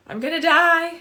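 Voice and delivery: high, loud gasp then panicked cry; mock terrified gasp. Coach voicing the frightened child, high loud gasp. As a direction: gasp as if falling to your death.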